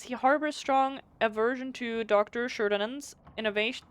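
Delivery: ordinary talk, nothing marked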